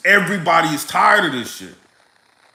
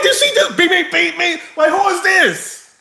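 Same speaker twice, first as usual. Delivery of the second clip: shocked disbelief; 10 semitones higher than usual. Retold or imitated. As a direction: play being stunned someone honked at you. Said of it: "I'm like".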